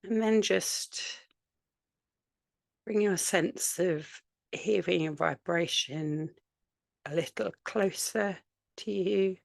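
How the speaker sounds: unhurried meditation voice with long pauses between phrases; guided meditation. Real, not acted. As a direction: gently guide listeners to settle, leaving space after each phrase.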